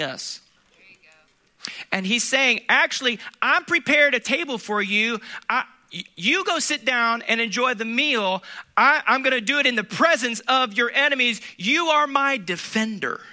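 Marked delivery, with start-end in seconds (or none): 1.9-13.2 preaching at full force. Acted. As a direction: press the point home to the congregation.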